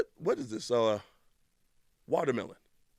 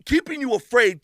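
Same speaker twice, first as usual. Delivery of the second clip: usual speaking voice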